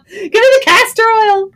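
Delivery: high, loud comic plea; mock panic. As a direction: squeal it, half laughing. Joking panic continues.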